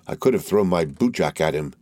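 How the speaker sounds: ordinary delivery, nothing marked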